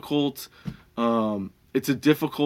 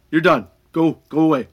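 retold throw-out; 4 semitones higher, 6 dB louder than usual. Throwing the customer out.

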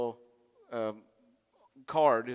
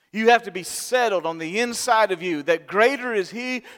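preaching climax; 6 semitones higher, 6 dB louder than usual. Real, not acted.

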